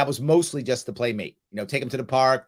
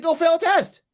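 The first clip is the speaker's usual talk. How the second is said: high, louder teasing dare; mock indifference; 5 dB louder than usual. Dad to daughter who won't study, mock careless. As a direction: dare her, playfully careless. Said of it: "I said".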